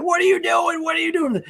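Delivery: high-pitched imitation of distraught pleading; upset, distraught. Podcaster acts out Don upset at his father, high distraught imitation. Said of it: "He's getting all upset".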